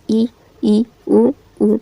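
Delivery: ई and उ said with the mouth almost closed; closed vowel demo. Shown as a demonstration. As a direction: keep the lips nearly shut on ई, ई, उ, उ. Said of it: "ई बोलकर देखिए पूरा लगभग बंद ही है". (लगभग बंद मुँह से ई, उ)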